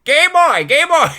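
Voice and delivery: loud, high-pitched playground jeering; childish taunt. Retold or imitated. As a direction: call it out high and loud like a teasing kid. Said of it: "like when I'm a child".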